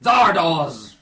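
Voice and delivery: loud, high drawn-out announcement; booming proclamation. Acted. Reader announcing the giant head, booming shout. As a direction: proclaim the name at full volume.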